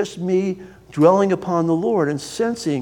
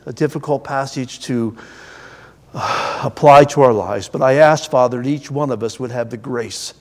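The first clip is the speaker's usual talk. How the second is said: closing prayer; 6 semitones lower than usual. Acted.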